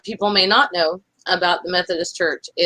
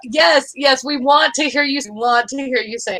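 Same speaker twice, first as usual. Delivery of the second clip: excited cheer; 6 semitones higher, 3 dB louder than usual. Real, not acted. Cheering on Sassy's singing contest.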